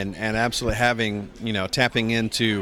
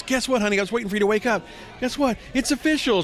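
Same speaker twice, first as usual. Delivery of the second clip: higher, lightly voiced excited girlfriend; 11 semitones higher, faster than usual. Fiancee waking him on his birthday with Ryu news, higher excited voice. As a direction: imitate an eager girlfriend breaking news. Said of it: "My girlfriend, who's now my fiance, goes".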